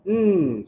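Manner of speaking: "mm" held as a bare nasal N without a vowel; soft N sound. Shown as a demonstration. Teacher showing what na becomes, held soft N sound. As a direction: stretch "mm" as the soft end sound of na. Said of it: "it changes the sound of the second character".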